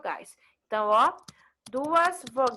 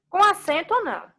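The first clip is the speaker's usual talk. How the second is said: lively question; 7 semitones higher, 5 dB louder than usual. Real, not acted. Teacher quizzing class.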